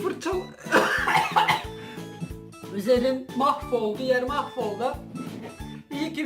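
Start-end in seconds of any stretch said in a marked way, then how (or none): 0.4-2.7 harsh coughing fit. Real, not acted. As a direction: cough hard, choking on it. Choking after the drink.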